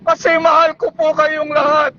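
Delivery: normal talking voice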